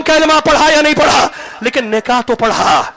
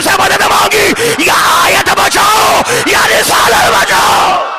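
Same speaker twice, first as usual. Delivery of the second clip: full-force preaching; 3 semitones higher than usual. Acted. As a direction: thunder the point at the crowd.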